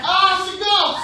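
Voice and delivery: loud, high scream of alarm; scared cry. Acted. Disciple in the boat spotting a figure on the sea, scared scream. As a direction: cry out in hammed-up terror. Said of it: "they all get scared".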